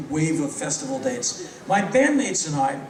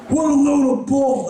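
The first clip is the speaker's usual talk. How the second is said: higher and louder scornful bark; rock heckle; 7 semitones higher, 6 dB louder than usual. Retold or imitated. [name] dismissing [name]'s answer, loud scornful heckle. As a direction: snap it at the speaker like a bored rocker.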